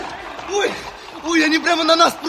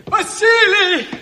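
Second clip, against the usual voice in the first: loud, slow, higher cry; desperate call; 5 semitones higher, 4 dB louder, much slower than usual. Acted. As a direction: call out in anguish. Боец зовёт погибшего командира. (отчаянный зов)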